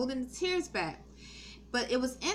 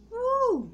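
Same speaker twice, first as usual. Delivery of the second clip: a sudden high whoop, imitating the manager; high mock woo; 13 semitones higher, 5 dB louder than usual. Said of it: "She was like".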